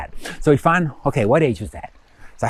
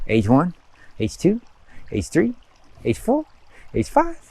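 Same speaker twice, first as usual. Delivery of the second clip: rising count; 5 semitones higher than usual. Shown as a demonstration. Counting ages one by one.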